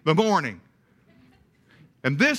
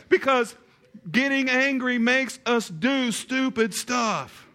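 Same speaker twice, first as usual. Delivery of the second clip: ordinary talk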